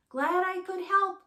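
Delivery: high, loud farewell call; a skunk calling goodbye across the clearing. A narrator's reading. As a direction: call after them as they leave. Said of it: "called the skunk".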